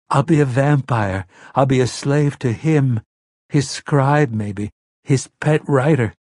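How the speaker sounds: put-on a seven-year-old confessing what he fears; frightened little boy.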